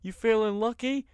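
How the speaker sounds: high, hyped-up welcome; show announcer. Acted. Host welcoming listeners to Monday Night Raw, hammed-up announcer. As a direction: sell it like a wrestling show opening.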